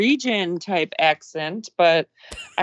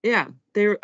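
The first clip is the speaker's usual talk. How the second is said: ordinary talk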